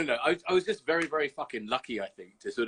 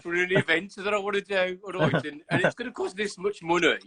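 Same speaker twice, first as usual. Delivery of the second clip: higher Brummie salesman pitch; mock pitching an event in broad Birmingham; a Brummie boardroom pitch, hammed up; Brummie accent pitch; 5 semitones higher than usual. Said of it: "and not speak like".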